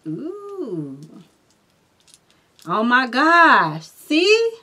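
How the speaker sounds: be floored by the card you pulled; amazed. Real, not acted. Reader stunned by the card she drew, amazed.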